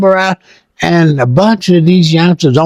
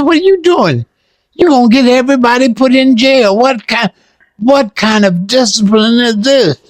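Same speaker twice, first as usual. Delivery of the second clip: retold angry scolding; 7 semitones higher than usual. Retold scolding of young Panthers.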